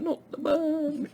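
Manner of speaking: laughing high as she says it. Real, not acted. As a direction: giggle through the words. Jokes about dreading the chili burn.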